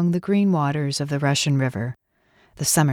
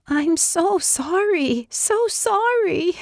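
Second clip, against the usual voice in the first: much higher, very slow, lamenting woman's voice; distraught driver; much slower than usual. Acted. Red car driver apologizing over the hurt dog, high slow lament. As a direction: drag out each sorry in high dismay.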